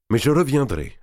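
une pointe de colère, la voix qui monte; ton rageur. A narrator's reading. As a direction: dit avec rage contenue, un peu plus fort. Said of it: "ajouta Guccio d'un ton rageur". (d'un ton rageur)

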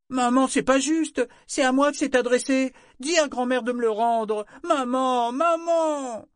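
pleading tantrum to mum; whining girl. A narrator's reading. Daughter protesting to her mother, ending in a shout.

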